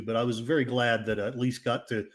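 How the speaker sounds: ordinary talk, nothing marked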